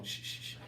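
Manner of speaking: soft hissed shh; shushing. Real, not acted. Quieting the whole live chat, soft shushing. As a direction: hush everyone down.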